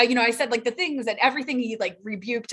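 ordinary talk

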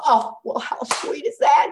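re-enacted motherly delight; touched delight. Touched by her son's answer.

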